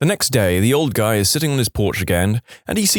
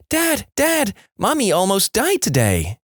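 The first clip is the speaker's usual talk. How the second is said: put-on panicked child; 10 semitones higher than usual. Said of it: "in a state of panic".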